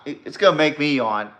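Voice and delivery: normal talking voice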